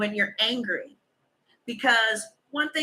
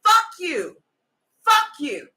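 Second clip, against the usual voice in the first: angry retort; 7 semitones higher, 4 dB louder than usual. Retold or imitated. Acted angry brush-off quote.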